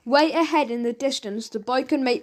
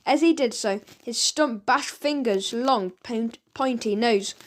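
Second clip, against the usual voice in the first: ordinary delivery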